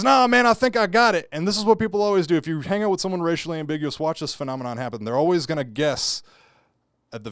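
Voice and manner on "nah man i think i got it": much higher and louder, sure of himself; loud confident dude. Retold or imitated. Mall dude sure he knows his ethnicity, loud. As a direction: blurt it like you cracked the code. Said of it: "And he goes".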